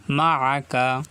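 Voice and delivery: মাআকা with the আইন pressed from mid-throat; Arabic pronunciation demo. Shown as a demonstration. Teacher repeats মাআকা after the rule, আইন pressed in the throat. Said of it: "কণ্ঠনালীর মাঝখান থেকে উচ্চারিত হবে আইন".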